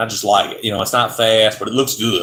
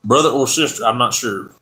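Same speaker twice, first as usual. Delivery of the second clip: usual speaking voice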